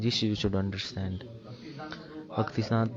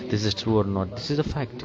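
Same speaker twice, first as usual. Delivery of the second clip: ordinary talk, nothing marked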